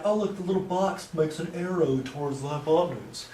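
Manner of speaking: soft, odd mock voice of other people; imitating weird people. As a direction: lightly mimic how those people talk. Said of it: "those people talk weird".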